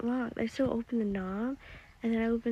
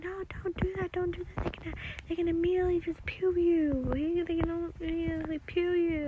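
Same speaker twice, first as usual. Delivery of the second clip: high, urgent pleading; panicked pleading; 9 semitones higher, 3 dB louder than usual. Retold or imitated. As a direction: beg him to stop, frightened. Begging dad not to open door.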